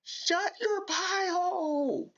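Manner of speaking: way up high and slow, a hammed-up impression of the cop; mocking officer voice. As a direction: stretch it out in a squeaky, mocking falsetto. Reactor mimics officer's scolding line.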